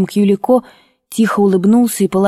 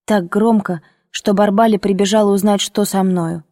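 usual speaking voice

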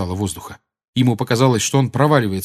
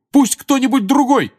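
high, loud plea; urgent shout; 6 dB louder than usual. A narrator's reading. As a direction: shout for help. Harry urging someone else.

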